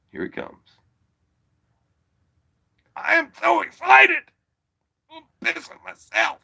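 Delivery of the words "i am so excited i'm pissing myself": squealing high, mock-giddy; hammed-up high-pitched glee; mock excitement. Excited about the caller.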